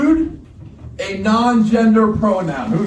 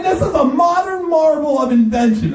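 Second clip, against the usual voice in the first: ordinary talk, nothing marked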